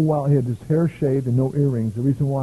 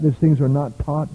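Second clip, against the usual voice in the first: ordinary talk, nothing marked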